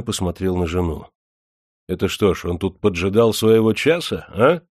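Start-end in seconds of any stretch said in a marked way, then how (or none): none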